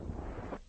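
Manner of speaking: sob. Acted. Breaks down crying.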